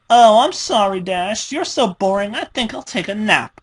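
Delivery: high, sing-song mock of the victim; mocking imitation. Pinkie mocks what Dash might say, high and sneering. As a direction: parrot her words with contempt.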